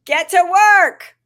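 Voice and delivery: high, loud, slow rallying cry; rally the crowd with it; shout. Shown as a demonstration. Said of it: "Try it again".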